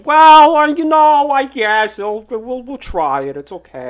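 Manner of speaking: loud, high opening that sinks into reassurance; put-on politician voice, drawn out and falling away; Bloomberg. Mimicking a mayor reluctantly agreeing to a sequel, hammed-up impersonation. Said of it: "I'll do my best Bloomberg impersonation".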